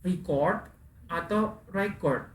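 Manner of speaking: "record" dito primeiro re-CORD, depois REC-ord, devagar; two stress patterns. Shown as a demonstration. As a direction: contrast "record" slowly as verb then noun. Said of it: "cara bacanya ada dua".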